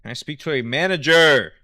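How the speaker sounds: hammed-up manager demand; shouted complaint. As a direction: yell like an angry customer. Mock Karen complaint.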